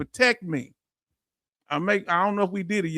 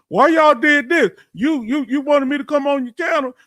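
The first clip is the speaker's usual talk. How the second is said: mock offended outburst; 6 semitones higher, 6 dB louder than usual. Streamer mocking critics, hamming an offended outburst. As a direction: play the offended man they hoped for. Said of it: "they wanted me to be offended to say".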